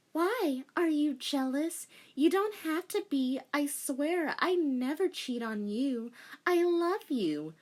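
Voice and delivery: high light pleading; girlish reassurance. A narrator's reading. Rei reassuring her jealous boyfriend, high voice. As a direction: reassure him sweetly.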